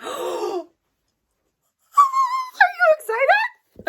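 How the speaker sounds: overjoyed gasp into a high squeal; excited squeal. Real, not acted. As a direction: squeal it at the camera, near tears with joy. Squealing at the camera over finds.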